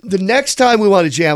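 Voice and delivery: ordinary talk, nothing marked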